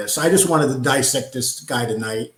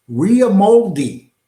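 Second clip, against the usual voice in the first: slow, loud and higher, milking the mocking name; mocking drawl; 6 semitones higher, 6 dB louder, much slower than usual. Host mocking a guest's on-screen name with a slow, loud drawl. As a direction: stretch it out to mock him.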